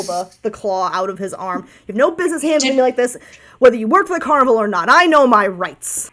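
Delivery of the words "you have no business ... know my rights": fast indignant protest, slowing on the last words; dude-voiced bird. Handled bird to its pursuers, fast indignant dude voice. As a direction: protest hurriedly as an affronted bird. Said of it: "I have to do a dude voice".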